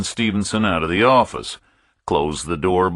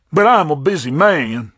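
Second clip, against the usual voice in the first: higher, pinched voice of a busy pompous captain; fussy fat captain; 10 semitones higher, 5 dB louder than usual. A narrator's reading. Captain dismissing a stranger, higher pompous voice.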